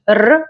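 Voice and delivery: trilled Russian R sound; rolled R. Shown as a demonstration. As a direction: roll the R as an isolated sound. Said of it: "manage to roll this letter".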